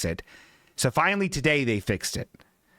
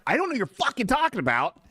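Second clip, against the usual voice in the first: pitched way up, incredulous and exasperated; baffled retort; 10 semitones higher, faster than usual. Retold or imitated. To the mechanic, fed up with car jargon, incredulous high retort. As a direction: throw up your hands at mechanic jargon. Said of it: "And I'm like".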